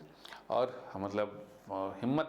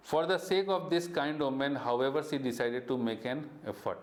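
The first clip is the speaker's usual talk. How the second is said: slow, deliberate read-aloud; storybook narration for students; story reading; 4 semitones higher, much slower than usual. A narrator's reading. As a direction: read the textbook line slowly to the class. Teacher reads story text aloud.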